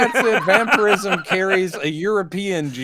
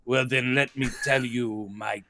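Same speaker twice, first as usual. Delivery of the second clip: lightly voiced old storyteller; 6 semitones lower, 8 dB quieter than usual. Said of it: "He goes".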